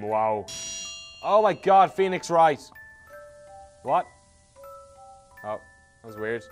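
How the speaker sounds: ordinary talk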